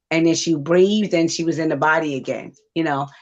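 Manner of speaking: ordinary talk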